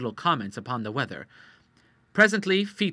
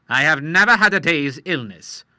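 louder insistence; indignant Admiral; 4 semitones higher, 10 dB louder than usual. Acted. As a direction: defend your health firmly.

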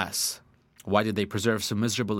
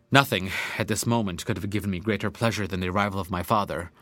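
plain reading voice

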